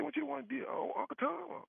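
squeaky teasing buddy, half laughing at it; imitating his friend. Friend on phone, voiced by teller.

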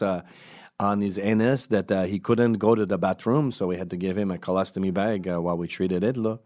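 put-on French Canadian doctor; French Canadian accent. Doctor reassuring a wart patient, French Canadian accent. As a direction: play it as a casual Quebecois doctor. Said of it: "Hold on, French Canadian".

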